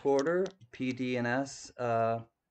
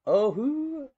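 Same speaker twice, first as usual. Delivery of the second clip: very high squeaky "Oh, who?"; squeaky high joke reply; 14 semitones higher, 5 dB louder than usual. Pretend.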